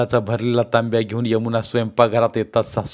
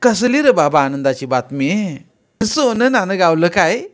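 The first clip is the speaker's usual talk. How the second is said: higher, louder village mother's voice, bantering; teasing old mother; 8 semitones higher, 5 dB louder than usual. Acted. Mother teasing her son, higher and louder. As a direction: rib him about finding gold.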